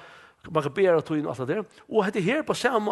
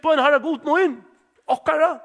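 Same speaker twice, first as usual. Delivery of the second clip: higher and louder, pressing every word; a desperate king crying for help; insistent loud plea; 9 semitones higher, 6 dB louder than usual. Retold or imitated. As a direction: beg harder than the first time. Same plea repeated, pressed harder.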